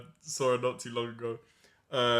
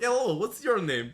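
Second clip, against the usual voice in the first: put-on higher-pitched Ukrainian accent; 7 semitones higher than usual. Comic imitating a Ukrainian office admirer, Ukrainian accent. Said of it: "I can't do the accent".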